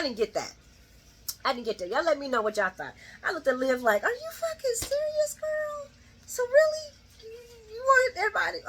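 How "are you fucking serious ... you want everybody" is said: very high-pitched mock disbelief; high, drawn-in "are you kidding me" voice; incredulous. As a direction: squeak it out in exaggerated disbelief. Retells own incredulous reaction to Liv.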